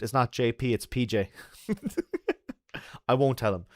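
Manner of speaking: ordinary delivery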